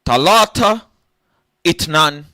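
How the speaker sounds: high loud shouted count; mock Arabic counting. Comic counting in Arabic, loud high calls. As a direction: call out the numbers high and loud, falling.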